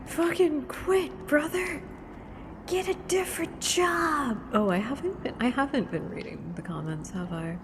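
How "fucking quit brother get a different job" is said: high-pitched, drawn-out mock rant; mock exasperated outburst. Streamer heckling a guy, high mock exasperation. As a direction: exclaim it high in playful outrage.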